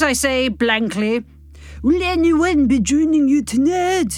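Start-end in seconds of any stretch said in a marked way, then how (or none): 1.8-4.2 put-on Swiss accent. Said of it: "Very Swiss".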